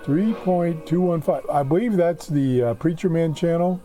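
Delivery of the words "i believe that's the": ordinary delivery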